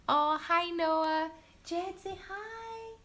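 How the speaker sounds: high, sing-song greeting to a little one. Real, not acted. Greeting her nephew and prompting her son, high and sweet. Greeting a child who came in.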